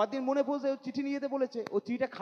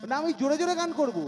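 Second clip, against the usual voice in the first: put-on a kid's higher, louder demand; whiny child insisting; 5 semitones higher, 5 dB louder than usual. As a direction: insist like a stubborn little boy. (বাবার কাছে জেদ ধরা ছোট ছেলে)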